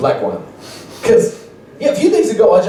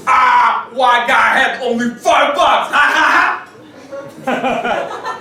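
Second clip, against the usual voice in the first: high shouted mocking laugh; gangster laugh; 7 semitones higher, much slower than usual. Big rapper mocks comic's five bucks with gangster laugh. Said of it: "gangster laugh".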